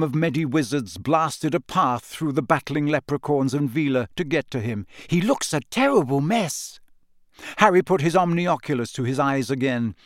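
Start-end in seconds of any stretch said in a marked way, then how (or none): 5.1-6.8 lightly voiced concerned girl, higher; a hint of a worried girl's voice; Hermione. A narrator's reading. Hermione on the injured player, lightly voiced concern. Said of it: "Hermione said".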